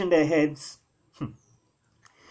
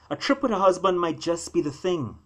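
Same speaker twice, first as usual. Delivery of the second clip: usual speaking voice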